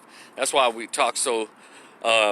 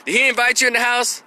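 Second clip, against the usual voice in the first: high-pitched, inquisitive question; curious questioner; 10 semitones higher, 4 dB louder than usual. Acted. Imagined listener asking about the visit, high and curious. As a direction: put on a nosy listener's voice. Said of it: "those of you who, who wondering".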